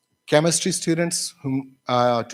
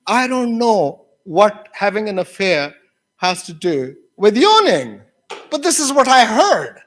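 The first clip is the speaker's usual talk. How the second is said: high, louder protest building to the end; imitated baffled student; 6 semitones higher, 5 dB louder than usual. Student telling teacher what she heard, baffled protest. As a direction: act out a puzzled student, rising in force.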